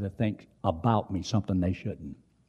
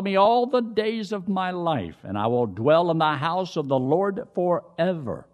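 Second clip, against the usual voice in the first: raised, sustained psalm-reciting voice; scripture recitation; 7 semitones higher than usual. Real, not acted. Preacher answers worry with the psalm, reciting it aloud. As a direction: recite the psalm straight through, pitched high.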